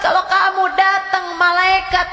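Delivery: loud, high, shouting warning; fiery preaching. Acted. Ustazah warning her listeners of torment in the grave, shouting.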